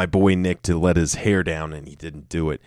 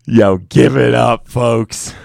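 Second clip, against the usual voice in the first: mock hype; 4 semitones higher, 8 dB louder, slower than usual. Hypes himself after take.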